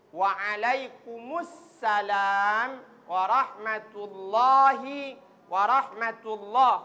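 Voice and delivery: slow model of warahmatullahi, lam held; careful recitation. Shown as a demonstration. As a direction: Warahmatullahi slowly with a clear lam. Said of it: "Huruf lam ini". (contoh ucapan warahmatullahi pelan)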